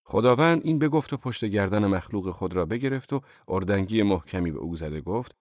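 ordinary delivery, nothing marked

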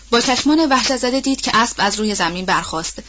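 plain reading voice

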